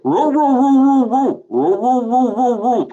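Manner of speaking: loud, high yapping roo roo; mock barking. Mocking a troll as a yapping dog, loud and high. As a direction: yap like a little dog at the troll. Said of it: "this is you".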